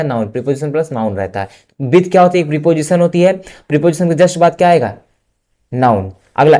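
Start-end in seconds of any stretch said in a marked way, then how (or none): none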